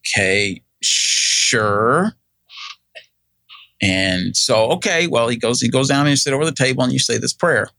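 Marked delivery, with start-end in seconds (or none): 0.1-2.1 hesitant, drawn-out retold agreement; unsure assent. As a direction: agree doubtfully, stretching out "sure". Retold unsure assent to salvation question.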